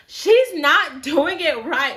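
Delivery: blatant complaint. Retold or imitated. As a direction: say it out loud for the whole salon to hear. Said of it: "my friend was just blatantly saying".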